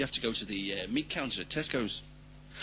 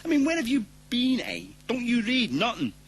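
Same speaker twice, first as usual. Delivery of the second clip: high, louder scolding; exasperated; 10 semitones higher, 4 dB louder than usual. Real, not acted. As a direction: berate him in disbelief. Exasperated rant.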